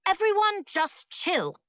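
high, drawn-out joke delivery; joking kid. A narrator's reading. Boy makes a pun to frozen schoolmates, slow and high.

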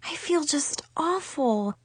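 hushed, slow guilty admission; sad apology. A narrator's reading. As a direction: confess it softly, full of regret. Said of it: "a wave of sadness washed over me".

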